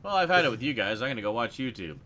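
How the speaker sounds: normal talking voice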